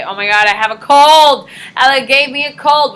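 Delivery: loud hammed-up alarm about a cold; mock hysterical complaint; dramatic panic. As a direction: overreact like it's a catastrophe. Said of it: "I would say".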